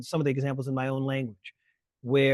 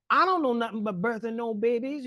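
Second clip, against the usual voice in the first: imitating a Hollywood slave caricature, higher and put-on; mock the old film maid's voice, pitched up; 8 semitones higher than usual. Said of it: "hear someone say".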